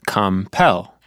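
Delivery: "compel" with the weight on PEL; stress on second syllable. Shown as a demonstration. Student shows Marta where the stress falls in "compel". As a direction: land hard on PEL in "compel". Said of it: "where's the main stress this time?".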